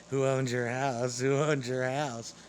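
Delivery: slow, drawn-out mimicry of nosy strangers; taunting, laboured repeat of a busybody's question; mocking imitation. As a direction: drag out each word to mock the question. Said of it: "people coming up playing that internet game".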